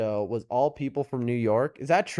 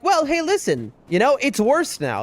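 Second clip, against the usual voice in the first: mock upbeat reassurance; 10 semitones higher than usual. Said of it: "You're like".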